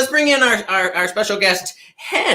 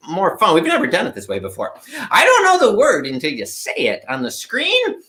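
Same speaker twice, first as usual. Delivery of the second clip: normal talking voice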